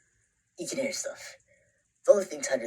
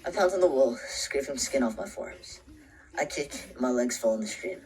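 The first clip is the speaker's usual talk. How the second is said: usual speaking voice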